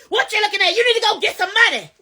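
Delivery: high-pitched scolding of a husband; hammed-up irritated nagging, high; mock angry wife. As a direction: act out laying into your husband, pitched high. Acted snapping at her husband.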